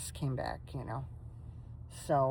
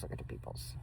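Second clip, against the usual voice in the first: whisper to dog; 11 dB quieter than usual. Real, not acted.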